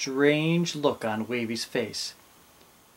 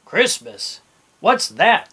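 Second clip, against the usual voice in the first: baffled, second question higher and louder; puzzled captain; 6 semitones higher, 7 dB louder, slower than usual. A narrator's reading. Captain hearing of Christmas first time, baffled. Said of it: "thought the captain".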